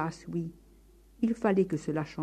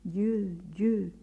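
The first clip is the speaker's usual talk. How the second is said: echo. A narrator's reading. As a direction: let the mountain answer back. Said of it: "répétèrent par trois fois en écho".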